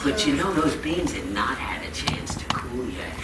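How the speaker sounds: hushed storyteller aside; whispered. Acted. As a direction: lean in and whisper the secret.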